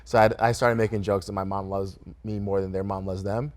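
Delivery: ordinary talk, nothing marked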